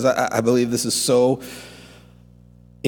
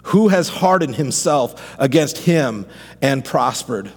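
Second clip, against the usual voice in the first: higher, impassioned sermon voice over music; fervent pastor preaching Job's cry; preaching at full force; 5 semitones higher than usual. Acted. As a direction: preach it out, rising to a climax.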